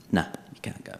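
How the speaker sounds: soft whispered no; hushed refusal. Retold or imitated. Embassy refusing his plea, in a hushed whisper. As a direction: breathe the refusal flatly.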